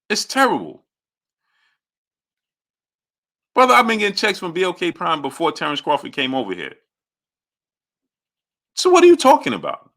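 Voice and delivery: high-pitched exasperated protest; indignant. Real, not acted. Streamer defending his record to a critic, high and indignant. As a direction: defend yourself in disbelief.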